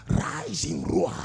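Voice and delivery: low breathy lion-like growl on Rise in roar. Shown as a demonstration. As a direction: let the lion rumble out of your throat. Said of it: "That lion-like spirit in me, rise in roar".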